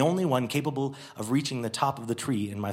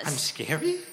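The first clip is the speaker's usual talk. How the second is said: a newborn asking its maker; innocent snowman; 3 semitones higher than usual. Acted. Snowman hurt question.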